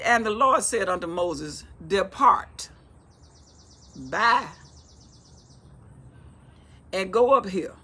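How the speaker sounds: loud, declaimed Bible reading; scripture reading. Acted. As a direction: proclaim God's command to Moses.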